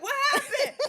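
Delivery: very high, loud panicked cry; mock terrified. Retelling waking up confused to her friend, mock terrified. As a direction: yelp it like someone jolted awake, hammed up. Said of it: "Girl, I was terrified".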